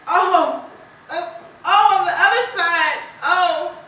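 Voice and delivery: horrified cry. Acted. Horror at the bird's wrung neck.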